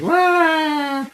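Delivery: high, loud mock baby wail; long, piercing pretend "wah"; mocking fake cry. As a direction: wail like a bawling child to tease. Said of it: "it was just completely mocking her".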